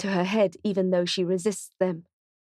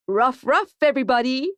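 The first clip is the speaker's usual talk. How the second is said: higher, louder cheery bark; high, loud puppy-mascot hello; robot puppy bark; 5 semitones higher, 4 dB louder than usual. A narrator's reading. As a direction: bark the greeting like an excited cartoon pup. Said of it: "he barks".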